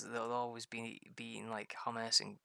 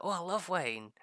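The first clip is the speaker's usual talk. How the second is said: higher, louder mock delight; imitated gushing; 6 semitones higher, 5 dB louder than usual. Husband imitating wife receiving wine, gushing. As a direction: gush like a delighted wife, put on. Retelling wife's delight at gifts.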